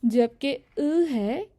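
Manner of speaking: अ said slowly with rounded lips, voiced from the throat; अ stretched out loud and slow; throaty French vowel. Shown as a demonstration. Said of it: "इसको आपने pronounce करना है ... गले से आवाज़ निकालनी है".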